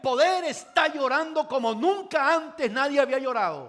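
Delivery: preacher amazed at the weeping ruler; excited, laughing. Acted. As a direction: marvel at it with a laugh. Preacher marvels, crowd laughing.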